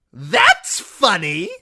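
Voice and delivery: high, loud, slow gleeful crow; crowing jester. A narrator's reading. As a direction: crow over the fallen man. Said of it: "Shagwell crowed".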